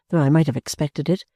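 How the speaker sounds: ordinary delivery, nothing marked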